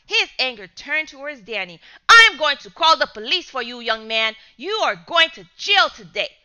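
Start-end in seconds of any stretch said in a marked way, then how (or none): 2.1-6.4 loud, hard threatening; angry threat. Acted. Father threatening Danny with jail, loud anger. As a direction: threaten the boy with the police.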